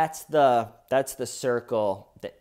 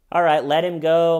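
louder, higher sarcastic go-ahead; mock cheerful release; 6 semitones higher, 10 dB louder than usual. Detectives to each other, mock cheerful louder release. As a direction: ham up cops caving.

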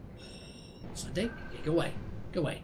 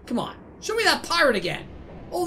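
ordinary talk, nothing marked

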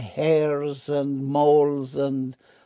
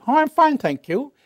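lightly voiced prim Eve, higher and louder; mock-demure Eve; Eve's voice; 11 semitones higher, 7 dB louder than usual. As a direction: answer politely in a falsetto Eve. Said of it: "a talking snake who says".

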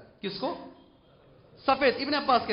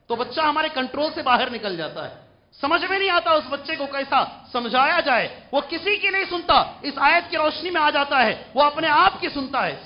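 high, loud sermon pitch; forceful preaching; 8 semitones higher, 8 dB louder than usual. Acted. Preacher complaining that youths heed nobody, forceful preaching. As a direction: hammer it out to the whole congregation.

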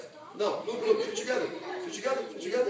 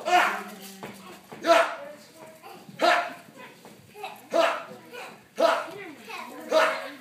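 short, loud, high shouts; explosive yell on each punch; martial arts yells; 5 semitones higher, 5 dB louder, slower than usual. Shown as a demonstration. Coach leading students' punches, sharp loud yells. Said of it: "Yell now, yell".

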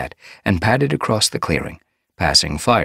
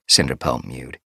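ordinary delivery

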